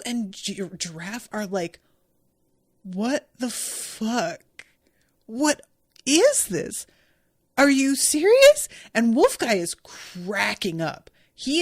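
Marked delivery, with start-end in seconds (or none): 2.8-8.7 slow stunned start, then a high, loud climb; incredulous outburst. Retold or imitated. Wolf and Giraffe react to Zoro using Usopp as a sword, incredulous and rising to loud. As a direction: go from disbelief to loud outrage.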